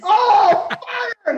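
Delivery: very high, loud yell; acted panicked shout. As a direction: shriek the order to shoot. Acted battle order at T-Rex.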